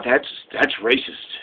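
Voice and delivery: lightly voiced interviewee, low and soft, slowed; mock low answer. To a pushy reporter, a mock low mumbled answer. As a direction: mumble the admission, low and quiet.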